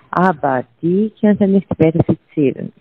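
ordinary delivery

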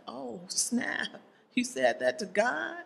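soft mock-shocked aside; hushed disbelief. Preacher reacting to Cain's answer to God, hushed disbelief. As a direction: react under your breath, as if scandalized.

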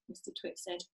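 ordinary delivery

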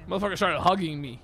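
normal talking voice

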